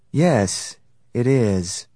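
usual speaking voice

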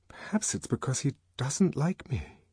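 to herself. Acted. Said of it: "she said to herself".

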